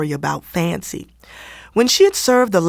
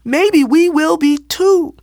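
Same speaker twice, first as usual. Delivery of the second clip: high, slow frightened whimper; a small scared voice rising; 10 semitones higher, 4 dB louder, slower than usual. A narrator's reading. Ruth whimpers that the girls may be shunned too. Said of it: "Ruth whimpered".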